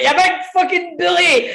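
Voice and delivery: high laughing whiny yell; scared mate shouting from behind the door; mock whine. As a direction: squeal it through laughter. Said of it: "Del's still shouting".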